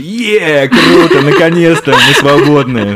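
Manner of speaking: high, loud, gleeful cry, breaking into a laugh; mock delight. Host voicing a wife cheering her husband's firing, gleeful. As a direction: play up the wife's joy at being free, laughing. Host voices wife's imagined relief.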